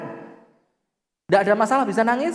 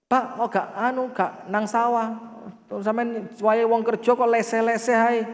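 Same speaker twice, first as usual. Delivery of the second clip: higher-pitched wife querying her husband; lightly voiced wife, pitched up; questioning wife; 5 semitones higher than usual. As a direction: put on a wife's mild nagging. Acted wife questioning idle husband.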